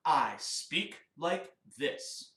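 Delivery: deliberate spoken model line; speaking voice. Shown as a demonstration. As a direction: say it slowly, spaced word by word. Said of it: "we have a speaking voice and a singing voice".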